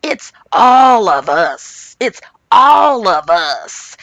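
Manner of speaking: slow, stretched-out imitation; mock emphatic, very slow delivery; drawn-out insistence. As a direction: drag out every word to include everybody. Mimicking Margaret blaming everyone in group.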